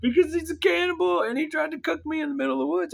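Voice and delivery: extremely high, loud panicked rant; hammed-up shrieking justification; hysterical squeal. Acted hysterical cannibal panic.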